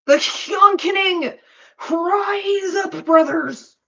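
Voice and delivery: loud, high rallying cry; fiery revolutionary speech. Retold or imitated. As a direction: rouse the troops, slow and loud. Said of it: "that's a Jacksepticeye reference".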